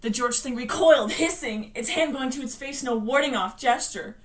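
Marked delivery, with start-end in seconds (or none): none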